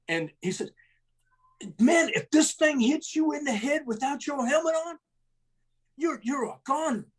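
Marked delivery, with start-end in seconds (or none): 1.8-7.1 imitating a gruff sergeant's scolding, much higher; hammed-up angry sergeant chewing out the troops; first sergeant telling soldiers to put helmets on, mock scolding.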